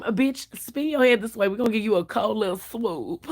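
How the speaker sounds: a hint of a flamboyant hairdresser; effeminate barber voice. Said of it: "Soon as he walk out the room".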